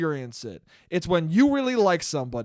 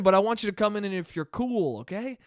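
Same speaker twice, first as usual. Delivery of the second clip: ordinary talk, nothing marked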